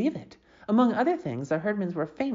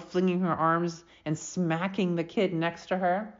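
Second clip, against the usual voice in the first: plain reading voice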